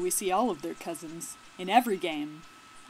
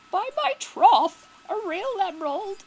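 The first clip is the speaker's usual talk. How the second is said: hammed-up gasp of wonder, pitched way up; very dramatic; 12 semitones higher, 10 dB louder than usual. Player voices the band all gazing at the emerald, hammed-up drama. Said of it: "gonna look at this very dramatically".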